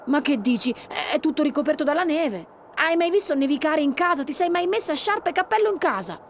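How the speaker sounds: exasperated brother; incredulous protest. Acted. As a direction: argue she's being silly. Brother argues with sister.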